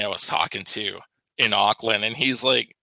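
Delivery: normal talking voice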